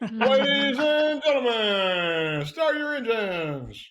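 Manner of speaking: slow, booming, high race announcer. Pretend. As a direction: stretch it out like a stadium announcer. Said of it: "get my announcer voice going".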